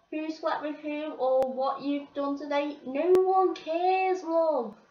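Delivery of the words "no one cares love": slow, pointed, higher brush-off; dismissive put-down. Real, not acted. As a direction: cut her down to size. Mocking the bitchy girls on bus.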